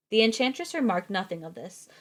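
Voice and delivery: ordinary delivery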